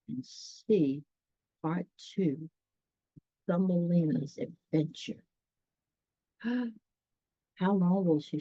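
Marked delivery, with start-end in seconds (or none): none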